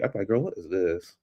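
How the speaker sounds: ordinary delivery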